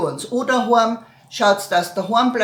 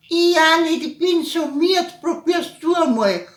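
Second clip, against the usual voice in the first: tired mom voice; 7 semitones higher, 3 dB louder than usual. Retold or imitated.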